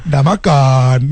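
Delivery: "Dah makan" flat and pointed, a serves-you-right jab; mock spiteful remark; sarcastic tone. Shown as a demonstration. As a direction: say it as a dig. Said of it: "macam soalan padam muka".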